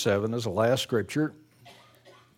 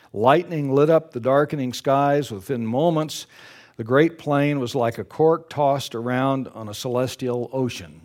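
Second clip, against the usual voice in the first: reads the story aloud to the congregation; paced storybook narration, lifting the drama; story reading; 4 dB louder than usual. Acted. Preacher reading a story to his congregation, in a storytelling voice.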